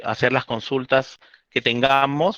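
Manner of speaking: ordinary delivery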